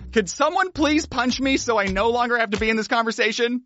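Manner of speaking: retold awkward plea on a high, held pitch; pleading to escape. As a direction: beg politely to be put out of your misery. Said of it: "it was probably along the lines of".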